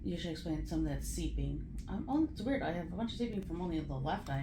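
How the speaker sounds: faint, drowsy post-op voice; barely audible, groggy from anesthesia; weak after surgery. Real, not acted. Patient in recovery room, groggy.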